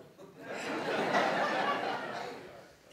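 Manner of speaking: a burst of loud, high laughter. Real, not acted. Big laugh at own story. (carcajada fuerte)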